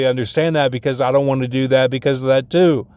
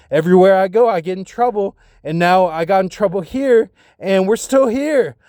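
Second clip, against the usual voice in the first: higher, mounting frustration; exasperated complaint; 5 semitones higher than usual. Retold or imitated. Telling her they are stuck in trouble, exasperated. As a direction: build in agitation toward the last words.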